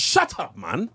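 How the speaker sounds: tries out a London accent on shut up; British slang attempt. Shown as a demonstration. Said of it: "The one I really been practicing".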